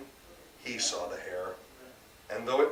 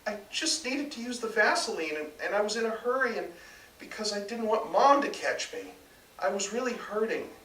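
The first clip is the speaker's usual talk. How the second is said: put-on higher young son's voice, defensive; higher voiced son, hurried and defensive; son pleading; 11 semitones higher than usual. A narrator's reading. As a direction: plead your case in a higher voice.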